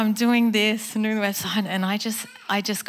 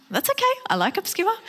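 much higher, bright eager reassurance; eager reply; 12 semitones higher, faster than usual. Retold or imitated. Speaker answering her friend's obscure word, high and eager. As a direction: chirp it back keenly. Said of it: "reacting to friend's word".

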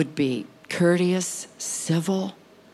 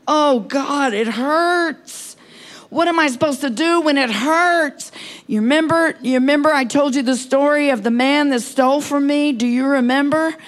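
high, loud, pleading cry of pain; anguished cry to God; 8 semitones higher, 4 dB louder than usual. Real, not acted. Preacher crying out to God about her pain, high and anguished. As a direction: cry out to God as if it hurts right now.

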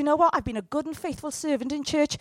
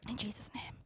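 hushed, breathy prayer whisper; 4 semitones lower, 14 dB quieter than usual. Acted. Preacher whispers a prayer to God. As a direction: breathe the words like a private prayer.

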